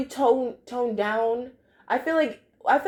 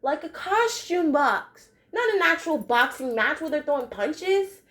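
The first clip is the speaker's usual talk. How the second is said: higher voice, a lightly voiced "oh, I get it"; sudden realization; 6 semitones higher, slower than usual. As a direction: act out a kid suddenly getting the joke. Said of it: "And I was like".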